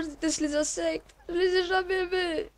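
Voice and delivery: ordinary talk, nothing marked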